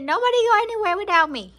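loud, fast cat voice; mock cat demands; voicing the cat. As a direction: speak as the bossy cat. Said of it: "He said".

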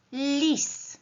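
"Lis" as one single high beat; one-syllable beat. Shown as a demonstration. Teacher clapping out syllables with pupils, "Lis" as one beat. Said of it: "Podzielmy teraz każdy z tych wyrazów na sylaby".

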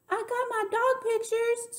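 put-on very high child-character scream; high, slow storybook scream. A narrator's reading. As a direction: squeal it out, high and drawn out. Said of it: "screamed Sweetie Pie".

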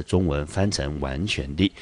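usual speaking voice

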